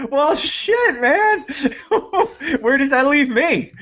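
high, laughing wail of mock dismay. Telling co-host how May caught them out, laughing mock dismay. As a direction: squeal it high through laughter. Retells own dismayed reaction, joking.

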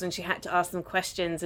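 ordinary talk, nothing marked

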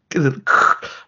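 short burst of mouth-made static; imitated tape cut. Film fan imitating the jump between vlogs, mock static. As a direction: make a noisy crackle for a video cut.

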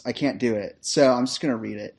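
normal talking voice

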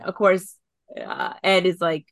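ordinary delivery

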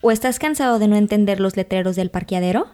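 loud commercial pitch; mock ad read. Co-host joining a fake commercial, announcing loudly. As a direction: sell it like a late-night TV ad.

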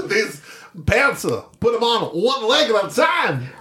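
high, halting mock-Georgian impression; Christopher Walken as Georgian. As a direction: do a hammed-up Southern celebrity impression. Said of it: "Christopher Walken was Georgian".

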